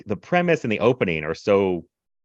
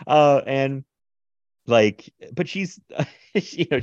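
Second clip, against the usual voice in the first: ordinary delivery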